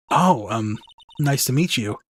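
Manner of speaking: usual speaking voice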